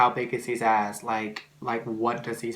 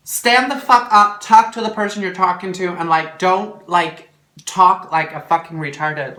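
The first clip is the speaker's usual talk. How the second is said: loud, raised-pitch scolding; exasperated rant; 6 semitones higher, 11 dB louder than usual. Acted. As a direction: lay into the mumblers at high volume. Ranting at people who mumble.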